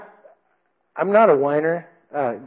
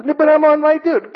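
very high, louder mock protest; pleading recruit; 13 semitones higher, 6 dB louder than usual. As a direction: imitate a recruit begging off. Recruit pleading with sergeant in quarrel.

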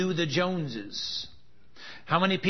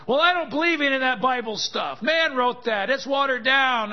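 a higher, louder, scoffing doubter; mocking skeptic; 9 semitones higher, 5 dB louder than usual. Preacher mimics a doubter dismissing the Bible, scoffing. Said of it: "be willing to put that to the test and say".

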